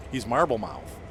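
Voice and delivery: ordinary talk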